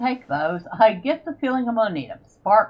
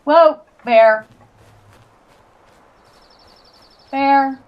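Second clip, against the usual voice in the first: startled; 6 semitones higher, 10 dB louder, much slower than usual. Real, not acted. Bear suddenly appears in game.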